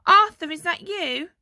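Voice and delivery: loud, high call from another room. A narrator's reading. Mother calls to her son from the next room, loud high call. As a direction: call out to find who's there. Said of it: "Their mother's voice called from the next room".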